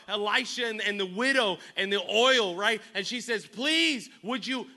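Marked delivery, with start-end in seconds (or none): 3.4-4.7 high, loud retold plea; pleading. Preacher voicing the widow begging Elisha, pleading. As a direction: beg urgently, pitched up.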